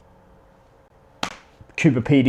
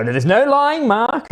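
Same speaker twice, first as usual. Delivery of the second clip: mock teacher; 10 semitones higher, slower than usual. Teacher scolding boy in story.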